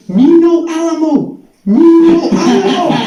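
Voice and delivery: high, loud, stretched-out pleading yell; comic cry of soldiers on the run; panicked mock shouting. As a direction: yell it like fleeing soldiers, hammed up.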